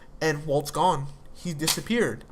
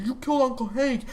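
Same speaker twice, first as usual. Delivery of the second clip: higher-pitched mock yell of a furious son; retold angry yelling; 7 semitones higher than usual. Son yelling at his father over the phone, retold angry yell. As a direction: voice the son's outburst, pitched up and heated. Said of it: "yells at Walt".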